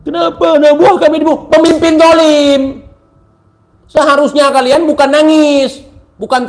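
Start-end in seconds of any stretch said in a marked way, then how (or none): 0.1-2.6 higher, fast mocking wail of a protester; mock whiny protester. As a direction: parody their outraged wailing. Mocking opponents' crying protest. (tiruan ratapan pendemo yang mengejek)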